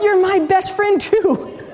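high, slow, laughing answer; laughing reply. Retold or imitated. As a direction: say it back through laughter.

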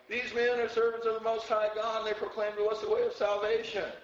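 high, loud, sustained cry-out; imitated yelling. Preacher acting the girl who follows Paul around, high insistent yelling. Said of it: "It means she was yelling it".

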